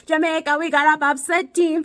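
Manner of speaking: high, loud imitation of a Jamaican accent; bad Jamaican accent. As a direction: sing it out in a mock Jamaican accent. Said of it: "my Jamaican accent is terrible".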